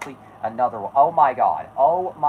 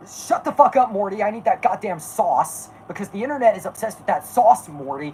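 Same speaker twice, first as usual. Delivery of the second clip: put-on higher, gruff scolding old scientist; Rick impression; 7 semitones higher than usual. Streamer plays Rick scolding Morty, gruff and impatient. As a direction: bark it at Morty like a drunk genius.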